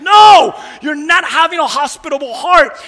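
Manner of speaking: loud, high burst on the no; shouted rebuke. Real, not acted. Preacher scolding churchgoers without a hospitable heart, shouted rebuke. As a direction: cut them off with a forceful shout.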